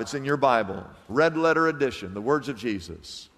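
ordinary talk

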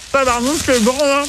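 talking with a mouth full; words round a bite of food. Real, not acted. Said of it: "je parle la bouche pleine".

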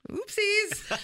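oopsies squeaked high through a laugh; laughing, very high and playful; real laugh on a high oopsies. Real, not acted.

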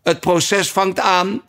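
high, slow cry from far away; distant call. A narrator's reading. A voice in the distance announces the trial, high and slow. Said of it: "in de verte hoorde roepen".

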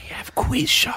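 whispering. Pretend. Echoes colleague in whisper.

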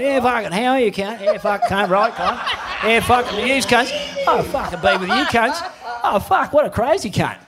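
hammed-up bogan chatter; mock Aussie banter; imitating locals. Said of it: "the people are saying".

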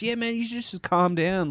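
high-pitched imitation of a placating bloke; placating gronk. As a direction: mimic him telling you to calm down. Imitates the man placating him.

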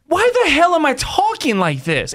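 high-pitched, incredulous self-questioning; baffled at your own voice; mock bafflement.

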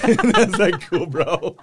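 high, amused words broken by real laughs; laughing. Real, not acted. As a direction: crack up while delivering the reply. Laughing over his son's verdict.

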